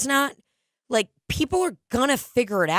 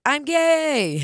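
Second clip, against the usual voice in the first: imitating Mark Normand; 7 semitones higher than usual. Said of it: "he's like".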